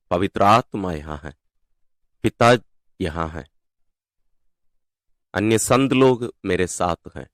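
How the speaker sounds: soft, low, with long pauses; prayerful meditation. Real, not acted. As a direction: quietly settle listeners into God's presence. Leads into God's presence. (धीमी आवाज़ में ध्यानमय प्रार्थना)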